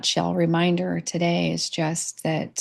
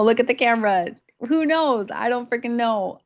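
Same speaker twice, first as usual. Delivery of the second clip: laughing; 5 semitones higher than usual. Real, not acted. Laughing, flustered at the camera.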